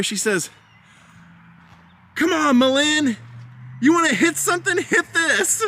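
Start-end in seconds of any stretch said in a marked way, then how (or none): 2.1-5.6 high-pitched mock dare; hammed-up movie quote, pushing a friend to act; playful goading. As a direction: egg her on in an acted, much higher voice. Acting Clary egging Malin on.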